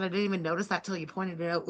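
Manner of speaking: normal talking voice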